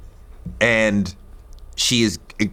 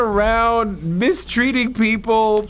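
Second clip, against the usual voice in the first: high, disbelieving and amazed; incredulous; 10 semitones higher than usual. Real, not acted. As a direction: pitch way up in disbelief. Incredulous rant about [name].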